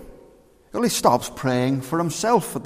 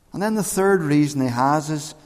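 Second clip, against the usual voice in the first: usual speaking voice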